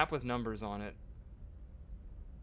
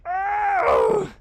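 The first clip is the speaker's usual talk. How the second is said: pained yelp; 16 dB louder than usual. Real, not acted. Pained outburst.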